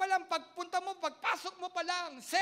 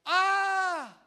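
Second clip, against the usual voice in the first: a sudden loud cry of despair; loud anguished cry; 4 semitones higher, 10 dB louder than usual. Acted. Pastor voicing the prodigal son's anguished cry. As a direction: let out a loud pained "ah".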